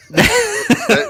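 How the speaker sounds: squeaky laugh through the word. Real, not acted. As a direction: break into a high giggle. Laughing at drink.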